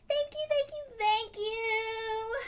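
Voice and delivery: high, loud, squealing thanks; overjoyed. Acted. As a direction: gush with joy, almost singing. Girl thanks for bike.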